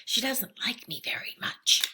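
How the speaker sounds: put-on girl whispering back to librarian. A narrator's reading. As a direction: keep it hushed in the library.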